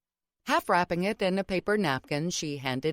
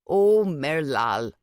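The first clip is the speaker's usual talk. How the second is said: slow, tender and a little louder, lingering on the name; deep affection; 5 dB louder, much slower than usual. A narrator's reading. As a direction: grandmother fondly soothing her apologetic granddaughter, slow and tender. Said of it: "she said with deep affection".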